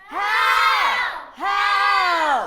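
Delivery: very loud, high cries for help. Acted. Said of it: "scream maybe louder this time around".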